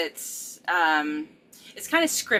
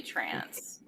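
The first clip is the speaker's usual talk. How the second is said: whisper; 11 semitones lower, 11 dB quieter than usual. Real, not acted. Whispering the word trance.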